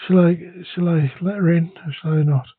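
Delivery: ordinary talk, nothing marked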